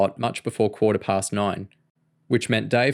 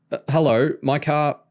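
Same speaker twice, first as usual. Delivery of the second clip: spluttering; 5 semitones higher than usual. A narrator's reading. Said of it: "Michael Patel spluttered".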